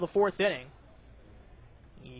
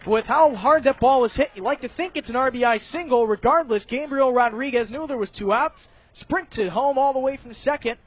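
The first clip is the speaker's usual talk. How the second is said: lively baseball play-by-play, raised and quick; 5 semitones higher, 6 dB louder than usual. Real, not acted. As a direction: call the hit for the radio crowd. Live play-by-play call.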